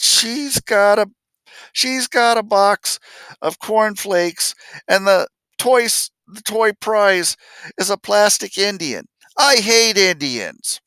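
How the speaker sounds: put-on high, slow sobbing child voice; child crying. Boy tells the stranger why he cries, high and tearful. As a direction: whimper it like a crying four-year-old. Retelling himself crying at four.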